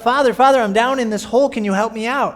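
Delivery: man in a hole shouts up to the priest, loud and pleading; shouting up. Retold or imitated. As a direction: yell up from the bottom of a hole. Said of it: "the man shouts out".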